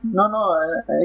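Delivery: ordinary delivery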